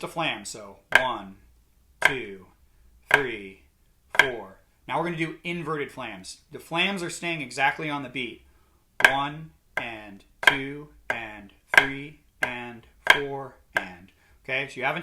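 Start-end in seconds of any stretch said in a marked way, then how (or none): 1.0-4.6 slow count with even gaps; counting in time. Shown as a demonstration. Drum teacher demonstrating quarter-note flams, counting in time. 9.1-14.1 slow, evenly spaced beat count; counting in time. Shown as a demonstration. Drum teacher showing flams stay on the beat, counting in time. Counting eighth notes aloud while playing.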